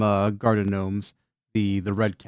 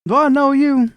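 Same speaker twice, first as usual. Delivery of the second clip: much higher and louder, suspicious; Cornbread's high voice; 14 semitones higher, 7 dB louder than usual. Acted. Cornbread challenges Olive in a high, loud voice. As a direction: demand it in a squeaky, loud voice. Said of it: "he says".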